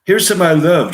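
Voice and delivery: put-on high, loud English-accented toast; ringing Shakespearean English accent. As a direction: declaim in a theatrical English accent. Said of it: "English accent".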